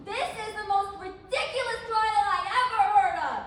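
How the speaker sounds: loud, indignant protest; outraged, building to a shout; indignant outburst. Acted. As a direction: raise your voice at the whole court. Alice protests the trial.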